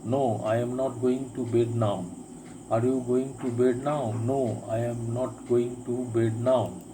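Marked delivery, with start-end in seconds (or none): none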